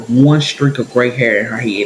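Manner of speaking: ordinary talk, nothing marked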